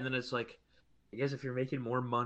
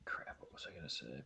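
breathy whisper to himself, much softer; whispered aside; 11 semitones lower, 18 dB quieter than usual. Real, not acted. Losing his train of thought mid-sentence, whispered to himself. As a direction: mutter it under the breath as if thinking aloud. Forgot what he meant to say.

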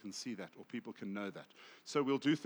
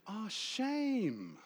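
high, long-drawn cooing sympathy; empathetic sympathy; 8 semitones higher than usual. Retold or imitated. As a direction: imitate someone gushing with pity.

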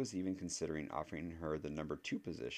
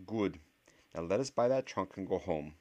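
stern witch voice; 3 semitones higher, 6 dB louder than usual. Acted. Voiced professor character.

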